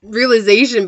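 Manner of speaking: slow, laughing through words. Real, not acted. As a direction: laugh as you say it. Laughs at her own joke.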